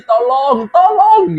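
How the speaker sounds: high, loud, hammed-up cry for help; mock cry for help. Imitating a scary voice calling for help in the dark, loud high cry. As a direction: wail tolong like a ghostly voice calling out. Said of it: "aku denger yang kayak gini".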